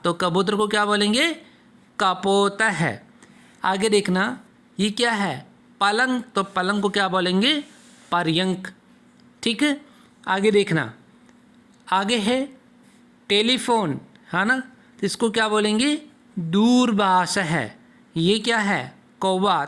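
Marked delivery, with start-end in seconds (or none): 2.0-3.1 कपोत said slowly and evenly for pupils to copy; slow model pronunciation. Shown as a demonstration. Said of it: "साथ साथ में आप ही उच्चारण करोगे". 16.4-17.8 दूरभाष said slowly and deliberately for repeating; slow model pronunciation. Shown as a demonstration. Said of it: "साथ साथ में आप ही उच्चारण करोगे".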